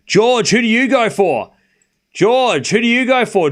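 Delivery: loud, high-pitched demand; indignant challenge. Real, not acted. Streamer challenges chatter George over his team, loud and indignant.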